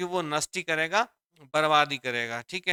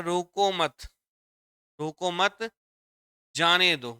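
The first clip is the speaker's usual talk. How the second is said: "रोको मत" as one unit, pause, louder "जाने दो"; pause after मत; 3 dB louder, slower than usual. Shown as a demonstration. Teacher showing students how the comma works, pause after मत. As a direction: repeat रोको मत, then release with जाने दो. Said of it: "कौमे से देखो यहाँ भाव चेंज हो गया".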